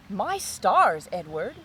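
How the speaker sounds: loud amazed outburst; father struck with wonder; exclamation. A narrator's reading. Father hearing Edward's song, loud amazed exclamation. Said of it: "Father exclaimed".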